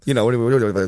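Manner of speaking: slurred, run-together mock mumble; words blended together. Shown as a demonstration. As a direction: rattle it off, running the words into one another. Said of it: "I just blend them together".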